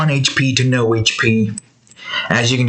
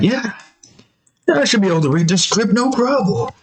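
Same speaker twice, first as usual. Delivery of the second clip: cocky Duke; 8 semitones higher than usual. Retold or imitated. Duke voiced boasting in story.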